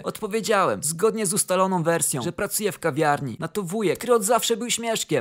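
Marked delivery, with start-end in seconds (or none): none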